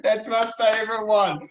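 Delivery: high, loud, real laughter in the words; laughing. Real, not acted. Author amused by host's crikey attempt, laughing. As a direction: say it through laughter.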